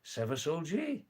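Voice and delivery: Seva Solji with the pitch climbing high on Solji; question tune rising. Shown as a demonstration. Teaching a Tamang phrase, voice rising on the question. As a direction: lift the voice up through Solji like a question. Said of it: "The voice is going up with the question".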